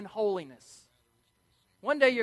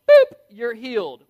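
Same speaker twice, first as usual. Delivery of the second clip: playful boop; 3 semitones higher, 13 dB louder than usual. Pretend. Jesus healing, playful retelling.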